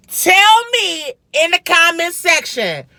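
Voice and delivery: high, drawn-out shout-out to viewers; emphatic call-out. Real, not acted. Vlogger appealing to viewers about a chicken spot, emphatic call-out.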